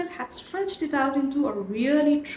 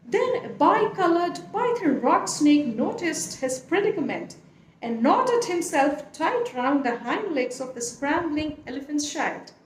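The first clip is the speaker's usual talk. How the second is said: usual speaking voice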